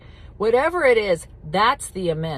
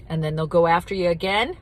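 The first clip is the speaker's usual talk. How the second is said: usual speaking voice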